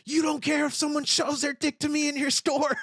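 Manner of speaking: high, loud outraged yell; full-force re-enacted tirade. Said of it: "then I started yelling".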